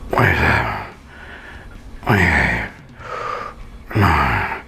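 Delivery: low, breathy counts pushed out between lifts; strained counting. Real, not acted. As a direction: count each rep through the effort. Counting reps during leg lifts.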